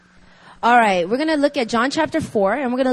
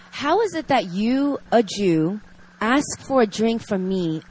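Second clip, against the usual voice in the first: reading scripture; 4 dB louder than usual. Acted. Reads scripture passage aloud.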